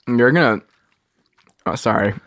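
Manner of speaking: talking with food in mouth; chewing while talking. Real, not acted. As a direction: speak through a mouthful. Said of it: "I'm chewing a".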